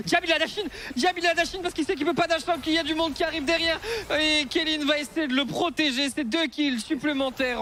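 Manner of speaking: high, loud fast caster call; excited play-by-play. Real, not acted. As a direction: ride the hype of the fight.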